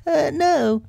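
very high put-on voice; disguised rabbit voice. A narrator's reading. Rabbit hiding who he is, high disguised voice. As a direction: deny it in a falsely high voice. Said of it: "in a different sort of voice this time".

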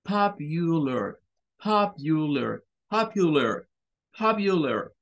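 popular slow with a short schwa, papyuler; slow model pronunciation. Shown as a demonstration. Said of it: "Es un sonido más corto". (popular despacio con schwa corta)

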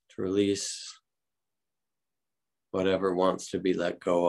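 unhurried calm meditation guide; guided meditation. Acted. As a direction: ease the group gently into stillness.

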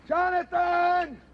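long, drawn-out high call. Acted. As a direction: holler slowly for your missing friend. Calls out for vanished friend.

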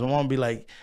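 ordinary talk

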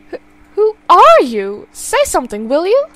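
put-on panicked old woman confronting an intruder; scared mother, voice rising; frightened demand.

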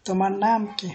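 usual speaking voice

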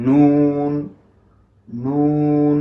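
noon with a long nasal hum, নুন held and ringing in the nose; demo of noon. Shown as a demonstration. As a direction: let নুন buzz through the nose. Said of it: "তো কিভাবে?".

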